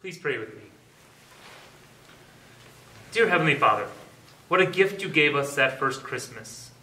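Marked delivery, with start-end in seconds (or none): none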